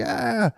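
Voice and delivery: high, soft frightened yelp; mock kid's yelp. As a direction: squeak like a scared boy. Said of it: "the kid was like".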